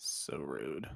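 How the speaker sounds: whispered aside; whispering. Real, not acted. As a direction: hiss it under breath.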